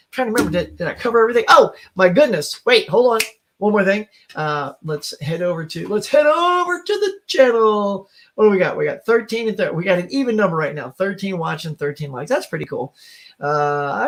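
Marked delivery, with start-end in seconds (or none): 5.9-8.1 high, drawn-out singsong segue; slow, high-pitched playful announcement; mock announcer call. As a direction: stretch it out in a high put-on voice. Repeats it as a show segue.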